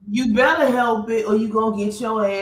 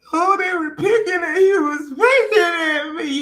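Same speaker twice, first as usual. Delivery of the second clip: mock crying; 7 semitones higher than usual. Retelling cop crying.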